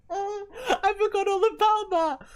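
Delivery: high, loud laughing squeal; helpless laughter. Real, not acted. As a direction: squeal it slowly through real laughter. Laughing at a remembered work mishap.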